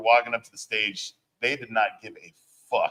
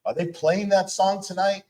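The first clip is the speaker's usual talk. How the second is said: high, louder incredulous question; imitated worried question; 11 semitones higher, 5 dB louder than usual. Johnny Z to the producer after soundcheck, imitated worry. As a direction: voice a worried onlooker, pitched way up.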